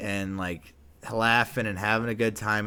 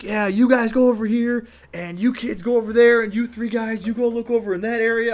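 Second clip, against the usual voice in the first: high, loud dispatching commands; loud orders; 11 semitones higher, 7 dB louder than usual. Retold or imitated. Narrator sending the kids off on missions, loud orders. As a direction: send the troops out with authority.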